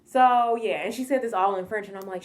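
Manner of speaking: ordinary delivery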